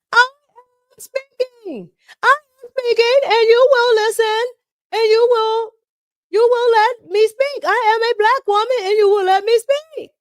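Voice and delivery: mocking a shouting congresswoman. Vlogger imitating Ayanna Pressley yelling at hearing, high loud mock yell. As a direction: shriek it like a politician demanding the floor. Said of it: "she yells at the top of her lungs".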